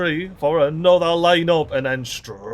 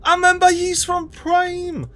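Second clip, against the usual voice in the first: very high cooing hello; cutesy greeting. Pretend. Gamer greets an alien plant from Prime, very high coo. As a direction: greet it like a long-lost pet, squeaky.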